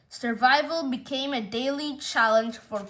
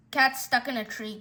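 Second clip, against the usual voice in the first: ordinary delivery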